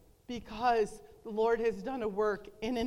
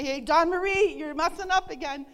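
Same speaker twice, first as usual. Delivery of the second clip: a sharp, louder scold, half-playful; mock scolding; 5 semitones higher, 7 dB louder than usual. The Lord she never hears, scolding her, mock harsh. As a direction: imitate a nagging voice calling out her mistakes. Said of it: "never in a bad way".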